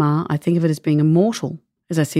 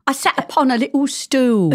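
Pam Ayres style; 10 semitones higher than usual. Pretend. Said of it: "Pam Ayres style".

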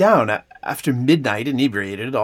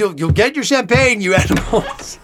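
loud, raised mock-indignant call; playful exasperated shout; calling to party guests; 6 semitones higher, 5 dB louder than usual. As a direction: holler back at a rowdy party, half laughing. Calling back to impatient party guests.

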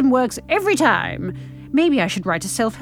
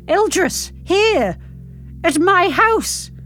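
high, emphatic exclamations; horrified disbelief; 6 dB louder, much slower than usual. A narrator's reading. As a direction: gasp out each phrase, appalled. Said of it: "processing the horror".

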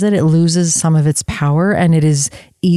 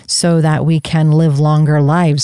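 ordinary talk

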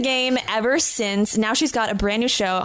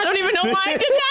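laughing; 7 semitones higher, much faster than usual. Real, not acted. Laughing at own impression.

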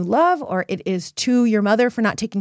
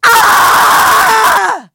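sudden piercing scream, high and loud; full-throated scream; 13 semitones higher, 12 dB louder than usual. Shown as a demonstration. Coach demonstrating a release scream to listeners: piercing scream. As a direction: let out a raw scream to show release. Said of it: "This is what I mean".

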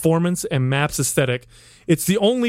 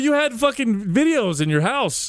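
mock indignant; 7 semitones higher than usual. Real, not acted. Playful accusation of Adam.

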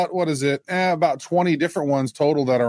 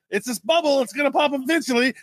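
higher, hammed-up warning; mock doomsayer; 10 semitones higher, faster than usual. Co-host imitating bubble doomsayers, high mock voice. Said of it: "and then the".

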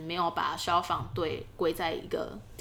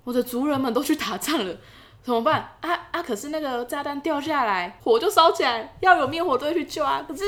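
mock panic; 8 semitones higher, 6 dB louder than usual. Voicing panicked Japanese officials.

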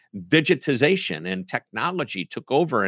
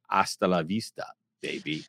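put-on low, soft German-accented Arnold voice; Terminator line; 5 semitones lower, 5 dB quieter than usual. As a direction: growl it out like the Terminator. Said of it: "I could have said".

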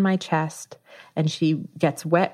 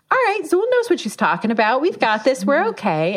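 high, quick pep talk to oneself; retold self-reassurance; 8 semitones higher than usual. Labouring mother trusting the doula, high-pitched acted self-reassurance. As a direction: talk yourself into calm, pitched up.

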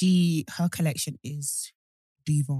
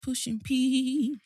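playful put-on voice; 4 semitones higher, much slower than usual.

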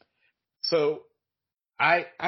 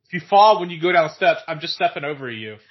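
louder, defiant yell; parting shout; 3 semitones higher, 10 dB louder than usual. Retold or imitated. Grandson yells a threat after his angry grandmother. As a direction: hurl it after her as she leaves.

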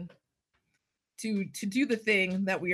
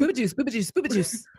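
quick, louder chant; three times fast; 4 semitones higher, 5 dB louder, faster than usual. Pretend. Co-host takes up the dare to say it three times, fast. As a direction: rattle it off rapid-fire. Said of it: "three times fast".

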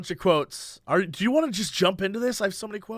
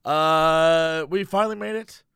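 usual speaking voice

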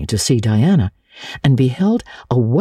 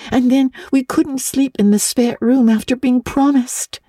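put-on high, earnest girl's voice pleading a case; an orphan girl defending her friend; eager little girl Anne; 11 semitones higher than usual. A narrator's reading. Anne pleads with Miss Barry for Diana, high and earnest.

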